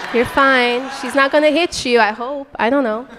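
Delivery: ordinary delivery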